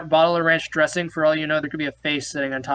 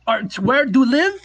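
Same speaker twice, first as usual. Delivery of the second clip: higher, louder put-on Norwegian accent; mock Norwegian accent; 7 semitones higher, 5 dB louder than usual. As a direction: ask eagerly as the Norwegian woman. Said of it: "this accent is".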